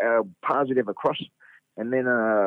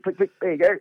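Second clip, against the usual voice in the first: ordinary talk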